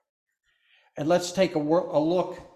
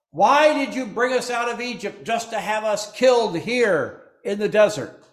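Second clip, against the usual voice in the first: raised, higher whining complaint; complaining Israelites; 6 semitones higher, 5 dB louder than usual. Acted. Israelites grumbling to Moses, a raised whining complaint. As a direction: grumble at Moses like the scared crowd.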